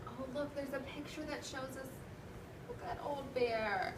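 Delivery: ordinary talk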